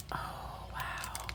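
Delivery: breathless whispered wow; awed whisper. Real, not acted. Opening a gift, awed whisper. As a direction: whisper in awe.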